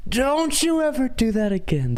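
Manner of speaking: high, stretched mock scolding voice; impression of the speaker. Streamer mimicking the rally speaker's little scolding bit, high mock voice. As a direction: squeal it like a playful nagging scold. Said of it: "impression of him".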